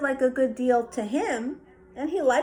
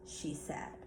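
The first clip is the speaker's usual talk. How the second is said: ordinary delivery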